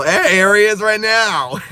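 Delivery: light up as your brother walks in; delighted shout of surprise; excited greeting. Real, not acted. Brother suddenly arrives on stream.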